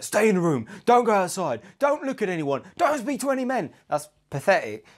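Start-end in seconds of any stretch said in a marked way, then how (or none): 0.0-3.7 rising mock orders from a controlling husband, higher and higher. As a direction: lightly play a possessive husband barking rules. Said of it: "a man who's like".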